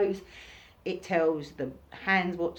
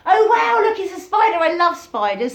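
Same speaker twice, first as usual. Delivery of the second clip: much higher and louder, delighted squeal; spider lover; 13 semitones higher, 12 dB louder than usual. Retold or imitated. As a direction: squeal with joy at finding a spider. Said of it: "You might go".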